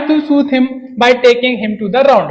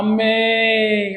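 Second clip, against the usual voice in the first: long drawn-out wail of mummy; crying for mummy; 3 dB quieter, much slower than usual. Retold or imitated. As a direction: stretch mummy into a slow, lost cry. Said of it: "और रोने लगा". (रोते हुए धीमी पुकार)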